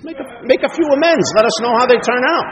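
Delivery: high, loud send-off; breezy urging. Retold or imitated. Mailman urging reluctant newcomer before step meeting, high loud send-off. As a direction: toss out the order as you leave.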